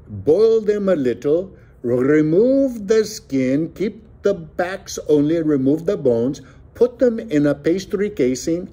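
high, loud theatrical recitation; declaimed recipe. A narrator's reading. Joaquin reciting the recipe by heart, high declamation. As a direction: recite it grandly, stretching the words. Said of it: "Joaquin declared".

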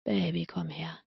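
soft half-asleep murmur; sleepy murmur. A narrator's reading. Half-asleep lover pulling her close.

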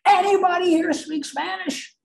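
high-pitched holler across the office; mock yell to the whole floor; retold scream. Coworker puts the phone down to ask the floor, mock screaming. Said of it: "he screams at the top of his lung".

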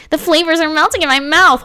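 high, hammed-up food rapture; mock gushing over food. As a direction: gush in a playful high voice.

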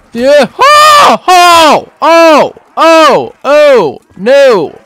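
string of high, loud cries; panicked yelps. Real, not acted. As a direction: yelp over and over, easing down gradually. Swarmed by zombies.

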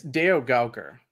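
Deogaukar said slowly and carefully, DEH-oh-gow-kar; correct name pronunciation. Shown as a demonstration. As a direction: spell out Deogaukar syllable by syllable, correcting the host. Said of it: "Did I say your last name right?".

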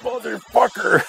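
high, slow, drawn-out curse in disbelief; stunned curse. Retold or imitated. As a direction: stretch it way up like the twist just hit you. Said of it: "I remember that moment where I'm like".

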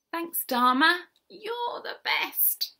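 high-pitched character voice; high thanks, slowing at the end; grateful llamas. Acted. Rescued llamas thanking their friend at her party, grateful.

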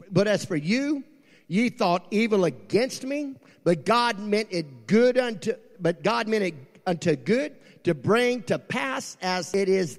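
high, loud, slow sermon build; full-force preaching. Acted.

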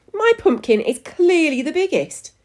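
high, squeaky insistence; indignant squeak. A narrator's reading. Missus Prune claims the win, squeaking high. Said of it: "squeaked Missus Prune".

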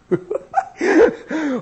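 high, loud laugh; burst of laughter. Real, not acted. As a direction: let a delighted laugh out. Laugh after whisper.

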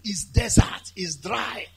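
ordinary talk, nothing marked

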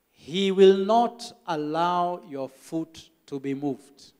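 reading the verse slowly, each word weighed; slow solemn reading. Retold or imitated. Church member reading scripture slowly to the pastor. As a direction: lay out the promise word by word.

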